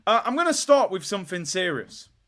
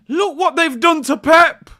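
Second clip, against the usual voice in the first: higher, slow, loud mock horror; mock outraged shout; 11 semitones higher, 12 dB louder than usual. Streamer mock-mourning Pep's scratched face, loud outrage. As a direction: wail it like a shocked fan.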